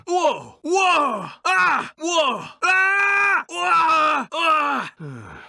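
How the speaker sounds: loud, high startled yells of alarm; pained shouts. Acted. Hero caught in an attack, yelling out in alarm. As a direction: cry out as if you're being thrown around. Effort sounds, falling or attacked.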